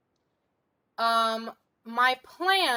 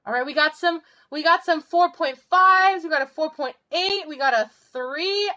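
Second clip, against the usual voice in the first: high, loud, bubbly score callout; excited; 6 semitones higher, 6 dB louder than usual. Real, not acted. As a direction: cheer the numbers out brightly. Excitedly reading out check-in scores.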